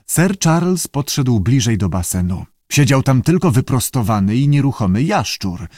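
usual speaking voice

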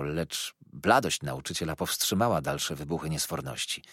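ordinary delivery

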